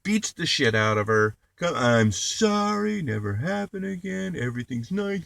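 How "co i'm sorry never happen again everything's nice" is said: mock abuser apology. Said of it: "abusive husband to his wife".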